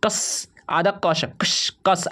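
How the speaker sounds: normal talking voice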